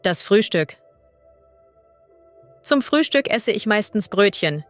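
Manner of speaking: ordinary talk, nothing marked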